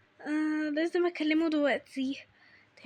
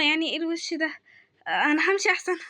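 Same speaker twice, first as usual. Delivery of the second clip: usual speaking voice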